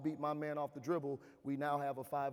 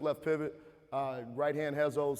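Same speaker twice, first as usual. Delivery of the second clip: ordinary delivery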